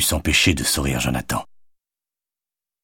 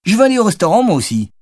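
put-on very high, louder excited kid voice; happy little child, pitched way up; delighted child; 4 dB louder, faster than usual. A narrator's reading. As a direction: chime in with childish glee. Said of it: "se réjouit Charlie".

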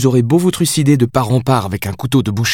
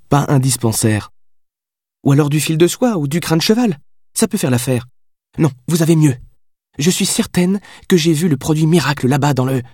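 higher, quicker voice for the heroine; lively young woman, pitched up; young woman's voice; 7 semitones higher than usual. A narrator's reading. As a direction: chatter on, sure of herself. Heroine rattles on.

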